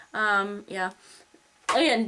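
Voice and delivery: ordinary talk, nothing marked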